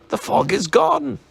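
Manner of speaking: happy cry at the clear morning; happily. A narrator's reading. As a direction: announce it with glee. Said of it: "he said happily".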